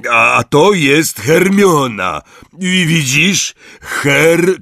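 high, slow, loud announcing; loud introduction. A narrator's reading. Hagrid introducing Hermione to the giant, loudly. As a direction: call it out, breaking off mid-name.

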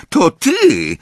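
high, loud accusing cry; a girl turning on her friend in anger. A narrator's reading. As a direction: point the finger and shout. Accuses friend after branch falls.

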